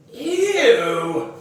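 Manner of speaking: high, loud grossed-out ew; girl grossed out by crickets; disgusted ew. Acted. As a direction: recoil in disgust.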